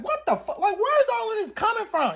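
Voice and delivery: very high, incredulous retort; high-pitched, bewildered protest; shocked disbelief. Retold or imitated. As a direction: re-enact being blindsided by the attack. Acting out shocked reply to attack.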